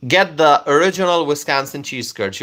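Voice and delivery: normal talking voice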